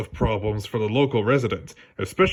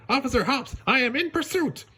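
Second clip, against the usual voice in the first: high put-on voice, proud and official; Judy reporting; 13 semitones higher than usual. Judy reporting she's chasing the thief, high voice. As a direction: announce it like a proud rookie.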